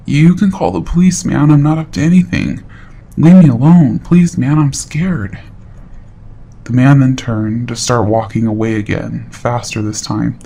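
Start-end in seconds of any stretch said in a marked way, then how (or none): 0.1-5.4 scared pleading. Retold or imitated. Creep frightened, begs to be left.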